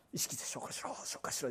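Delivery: unvoiced mock self-order; hushed comic self-command; mock whispered command. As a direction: whisper it to your stomach.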